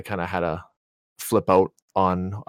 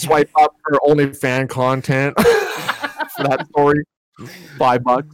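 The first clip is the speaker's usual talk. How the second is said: mock ad pitch; 7 semitones higher, 3 dB louder, much slower than usual. Joking about paywalling the story.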